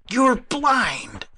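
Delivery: high, loud indignant outburst; harsh. A narrator's reading. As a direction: lash out in indignation. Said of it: "he said harshly".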